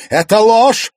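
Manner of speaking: very loud, high shout of denial; explosive, high-pitched rebuttal; furious cry. A narrator's reading. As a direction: cry out in outrage. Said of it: "яростно вскричала Лиза".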